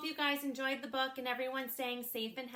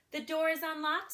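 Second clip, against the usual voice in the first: higher grandma voice; granny voice; 5 semitones higher, 5 dB louder than usual. Acted. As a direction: answer like a trusting old granny. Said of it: "said Granny".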